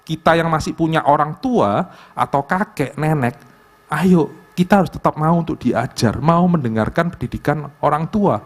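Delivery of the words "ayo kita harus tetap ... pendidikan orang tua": ordinary delivery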